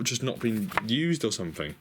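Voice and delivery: ordinary talk, nothing marked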